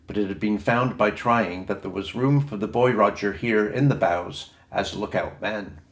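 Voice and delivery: ordinary delivery, nothing marked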